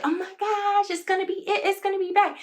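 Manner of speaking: high, gushing mock excitement; squealing like a hopeful fan online; excited fan gush. Online fans gush over the candle's return, high and excited. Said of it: "are like".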